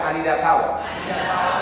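drawn out slowly with a laugh; slow call-and-response line. Shown as a demonstration. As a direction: stretch it out for the crowd to repeat. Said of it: "Everybody say".

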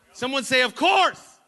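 high, loud call to the crowd; rousing shout. Real, not acted. Preacher prompting the congregation to answer, rousing shout. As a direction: shout for them to echo you.

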